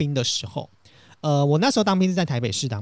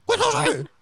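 high, exaggerated mock of the male officer; mocking the colonel. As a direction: lampoon the colonel whining. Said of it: "然后那个男长官就说". (夸张模仿男长官，声音尖高)

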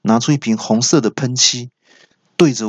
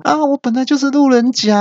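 much higher voice calling from far off; old man calling back. Retold or imitated. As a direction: answer back over his shoulder from a distance. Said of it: "还不忘记回话说".

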